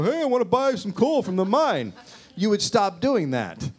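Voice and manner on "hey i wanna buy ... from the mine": higher, sing-song buyer voice; chirpy coal shopper; mock cheerful customer. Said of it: "we go".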